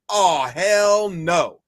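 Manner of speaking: slow, drawn-out oh hell no; emphatic refusal. Retold or imitated. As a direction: stretch it out like an offended nation. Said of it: "all of Canada was like".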